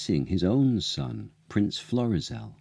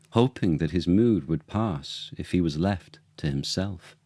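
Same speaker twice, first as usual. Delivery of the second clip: usual speaking voice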